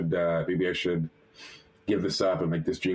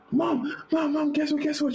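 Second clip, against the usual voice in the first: very high excited squeal; high-pitched, breathless calling to mom; bursting with news. Retold or imitated. Excited call to mom about internship.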